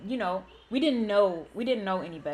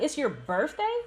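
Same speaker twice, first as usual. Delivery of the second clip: higher, incredulous questions; imitating surprised friend; 8 semitones higher than usual. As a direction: act out the friend's disbelief. Best friend learns of hidden birthday.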